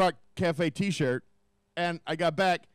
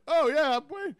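mock crying; 10 semitones higher, 4 dB louder, slower than usual. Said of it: "You're crying".

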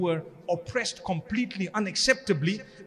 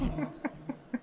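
breathy laugh; 7 semitones higher, 8 dB quieter than usual. Real, not acted. Laughing at own joke.

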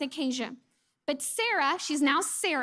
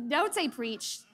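normal talking voice

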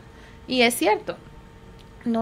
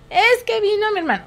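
put-on higher, louder child's excuse; 9 semitones higher, 5 dB louder than usual. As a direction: answer like a kid. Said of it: "niño explica". (voz de niño, más aguda)

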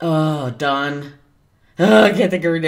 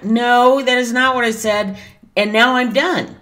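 angry retort; 6 semitones higher, 5 dB louder than usual. Retold or imitated. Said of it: "So I finally wrote today saying".

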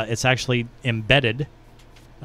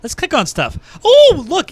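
excited; 14 semitones higher, 6 dB louder, slower than usual. Real, not acted. Excited clicking through the website.

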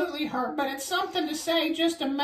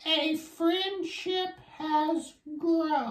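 slow storybook reading; much slower than usual. Acted. Child reading the book.

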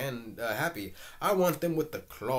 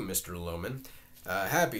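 ordinary talk

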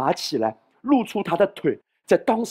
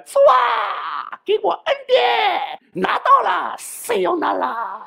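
high, crying-sounding begging for grace; mock whiny prayer; 3 dB louder, slower than usual. Preacher mocking a believer begging God for grace. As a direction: whine like a greedy child grabbing gifts.

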